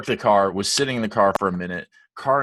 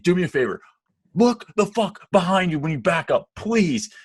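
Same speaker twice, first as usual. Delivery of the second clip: high, loud furious telling-off; angry yelling; 9 semitones higher, 4 dB louder than usual. Retold or imitated. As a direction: bark the order at the driver. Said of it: "I'm, like, screaming".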